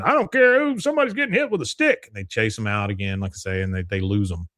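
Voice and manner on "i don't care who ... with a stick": much higher and louder, fast; mock shout. Elvin yells at the crowd at the stick fight, loud and high. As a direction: bark it at the crowd.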